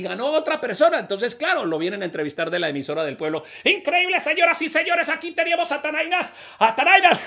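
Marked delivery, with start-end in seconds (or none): 3.6-7.2 high excited radio announcer. Acted. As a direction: announce it like a small-town radio host.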